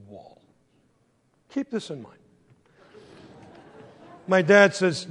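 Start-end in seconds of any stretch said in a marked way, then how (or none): none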